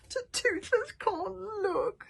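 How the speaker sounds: high slow whimpering stutter; whimpering little dragon. Acted. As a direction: whimper it behind your wings. Toothless hiding eyes.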